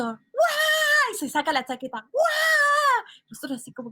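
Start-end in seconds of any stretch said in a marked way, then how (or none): 0.0-1.1 high startled yell of a big man; retold scream. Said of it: "pega un grito". 2.1-3.0 high startled yell of a big man; loud high panicked cry; retold scream. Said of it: "hace un grito así".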